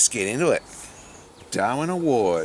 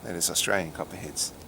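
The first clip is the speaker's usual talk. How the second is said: ordinary talk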